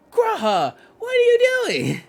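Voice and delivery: loud, high, slowed disbelieving yell; incredulous shout. Real, not acted. Streamer yelling at a companion character, a loud high disbelieving shout.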